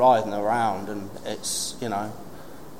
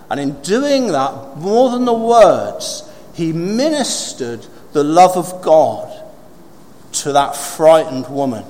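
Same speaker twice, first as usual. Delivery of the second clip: emphatic preaching; 5 semitones higher, 5 dB louder than usual. Real, not acted. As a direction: press the point home to the congregation. Drives home the point.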